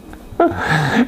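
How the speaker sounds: petit rire aigu; rire amusé. Real, not acted. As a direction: ris de ta propre anecdote. Rit de sa propre anecdote. (petit rire spontané)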